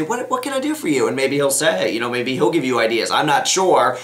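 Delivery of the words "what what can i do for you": high-pitched, gentle sibling asking to help; earnest offer. Acted. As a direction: model a caring question, pitched well up. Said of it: "look him right in the face and say".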